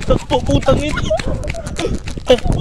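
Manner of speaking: yelling run, high and loud; panicked shout on the move; shouting while running. Real, not acted.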